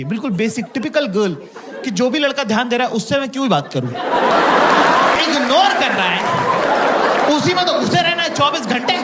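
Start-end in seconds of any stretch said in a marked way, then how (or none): 1.8-9.0 higher, stretched, sing-song mock girl voice building to a shout; mocking typical girl. Comic mocking girls who chase boys ignoring them, higher sing-song mock voice rising to a shout. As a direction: parody a girl chasing the boy who ignores her.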